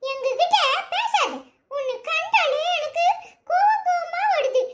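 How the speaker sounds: high, laughing jeer at him; mocking taunt. Neighbour taunting the groom who abandoned her, laughing mockery. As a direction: laugh at him while you scold.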